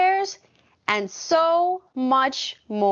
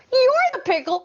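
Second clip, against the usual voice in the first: ordinary delivery, nothing marked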